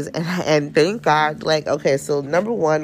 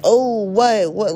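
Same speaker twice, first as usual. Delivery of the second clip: jumpy, higher "what, what"; startled, a bit louder; 7 semitones higher, 3 dB louder, slower than usual. Retold or imitated. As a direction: spin round caught off guard. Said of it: "And I'm like".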